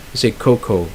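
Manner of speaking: ordinary talk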